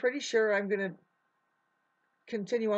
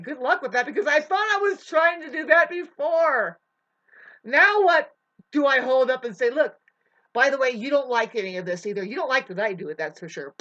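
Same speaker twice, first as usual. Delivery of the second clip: frustrated rant; 5 semitones higher, 9 dB louder than usual. Real, not acted. Ranting about a failed effort.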